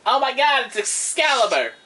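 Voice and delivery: excited exclamation. Real, not acted. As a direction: exclaim at a thrilling find. Mock discovery of the sword.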